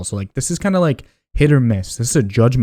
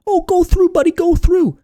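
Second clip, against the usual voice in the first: imitating the other driver; 4 dB louder than usual. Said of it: "He's like".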